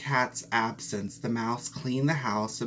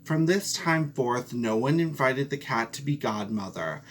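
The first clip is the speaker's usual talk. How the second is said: ordinary delivery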